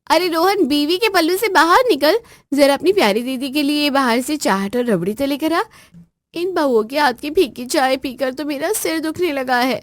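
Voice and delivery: high, loud mocking orders; bossy sister. Sister teasing her brother and demanding treats, high loud bossy voice. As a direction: boss the brother around.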